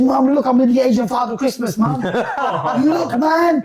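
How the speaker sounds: laughing through the quote. Retold or imitated. Daughter laughing to mum on FaceTime, laughing. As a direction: giggle it out, high. Laughing while quoting her.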